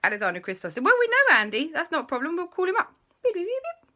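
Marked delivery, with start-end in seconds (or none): 0.9-2.9 put-on high, eager kid voice; boys' voice. Alexander and Christopher offering to call Andy, high. 3.2-3.9 high beeping like a phone dialing; phone beeps. Acted. Storyteller mimicking the phone ringing Andy, high beeps.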